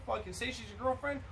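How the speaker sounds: ordinary delivery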